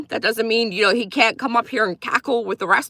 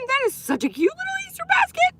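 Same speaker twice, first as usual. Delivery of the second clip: cute squeal. Real, not acted. Thanking viewer for emoji.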